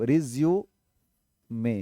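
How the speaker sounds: रिज्यूमे drawn out slowly, ending in a clear "may"; drawn-out pronunciation. Shown as a demonstration. Teacher showing students how the word is pronounced, slow रिज्यूमे ending in "may". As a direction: stretch रिज्यूमे into slow parts, re-zyu-may. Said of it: "उच्चारण को ध्यान दें".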